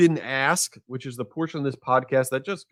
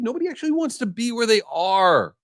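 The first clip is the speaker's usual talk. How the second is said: ordinary talk, nothing marked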